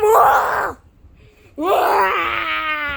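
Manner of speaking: loud high charging yell; hammed-up attack wail; battle cry. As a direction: charge in yelling. Attacking the cat-noise culprit.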